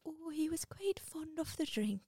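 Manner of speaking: a slow hushed Scottish-granny whisper; whispered Doubtfire. Retold or imitated. Doubtfire telling Miranda about Winston, whispered. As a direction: confide it in a whisper. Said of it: "Missus Doubtfire".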